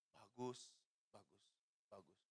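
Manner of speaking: soft, low, spaced-out words of approval; voice of God. Retold or imitated. Retold voice of God praising him.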